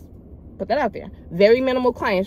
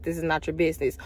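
dry flat tone; 3 semitones lower, 6 dB quieter, faster than usual. Retold or imitated. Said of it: "All in, like, a dry tone, like, flat".

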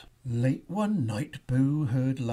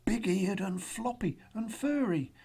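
higher, mildly frightened description; fearful; 6 semitones higher than usual. A narrator's reading. Boo points out the teddy bear, mildly fearful. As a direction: describe it as a nervous little monster. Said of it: "he pointed with fear".

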